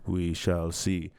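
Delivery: We shall see drawn out slow and low. Shown as a demonstration. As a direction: let "We shall see" sink slowly. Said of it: "repeats phrase in varied ways".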